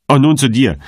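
high, loud barked command. A narrator's reading. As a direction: bark it at the gatekeeper. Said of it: "bellte der Freigraf".

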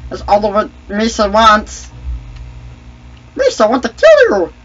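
high, loud cartoon character voice; Jar Jar Binks. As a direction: squeal it as an eager goofy creature. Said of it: "Playing Jar Jar Binks".

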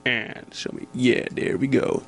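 ordinary talk, nothing marked

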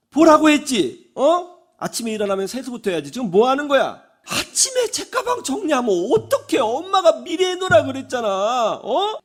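high-pitched, exasperated scolding; nagging mother. Retold or imitated. Mother scolds her son about morning chores and schoolbag, nagging. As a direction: nag relentlessly, voice climbing high. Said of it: "잔소리를 막 쏟아내요".

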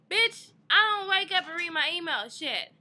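loud, high exasperated snap; furious retort at the RA; angry outburst. Retold or imitated. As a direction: blast it back at her, fed up. Ranting at RA's question.

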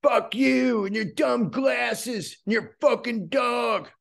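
high, slow hammed-up abusive rant; mock furious rant. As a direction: spit insults at a stranger in mock rage.

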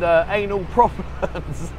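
slowed, laughing through the words; can't keep a straight face. Real, not acted. Laughing while telling viewers about the proctologist joke.

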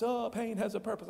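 a high, singsong mock of a preacher; mocking preacher cliche. Mocking preachers who say it to hurting people, high singsong. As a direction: parody the pious cliche. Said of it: "I've heard preachers say it".

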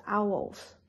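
"owls" as ow-wols, both syllables heard; two joined syllables. Shown as a demonstration. Teacher gives own pronunciation to a learner, "owls" in two connected syllables. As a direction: let the "wol" sound clearly in "owls". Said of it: "I personally will pronounce it as".

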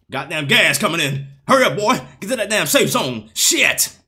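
mock military announcer nagging the player; Graves announcer impression. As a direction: bark the order like the Graves announcer. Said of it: "accent is extra instantiated".

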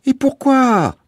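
Bouzig's voice. A narrator's reading. Bouzig reassuring the family, bright boyish voice. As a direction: play the cheerful young guide Bouzig.

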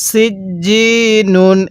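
slow সিজ্জিনুন with firm j and long i; drawn-out recitation. Shown as a demonstration. Teacher shows how it must be read, slow stretched সিজ্জিনুন. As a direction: stretch সিজ্জিনুন evenly on a held note. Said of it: "কিভাবে পড়তে হবে".

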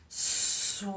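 SWIM with a long S sliding into a long W; snake sound blend. Shown as a demonstration. Teacher lets children try the word, drawn-out blend. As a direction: stretch the S and W of swim. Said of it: "So let's try it".

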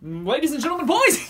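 high, loud ringmaster cut off by laughter; grand entrance announcement. Acted. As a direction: boom it out then crack up. Ringmaster intro blooper.

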